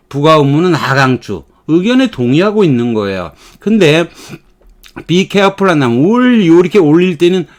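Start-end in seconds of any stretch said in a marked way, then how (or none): 6.0-6.6 "Will you?" pushed high and louder; irritated. Shown as a demonstration. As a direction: sound annoyed. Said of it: "짜증을".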